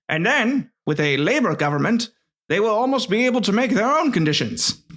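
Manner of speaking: put-on lightly old-fashioned letter reading; old-timey voice. Shown as a demonstration. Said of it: "give this more of an old-timey voice".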